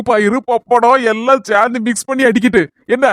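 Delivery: normal talking voice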